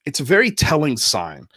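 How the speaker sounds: normal talking voice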